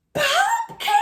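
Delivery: piercing high shriek of shock; startled scream of pumpkins. A narrator's reading. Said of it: "Rebecca Estelle shrieked".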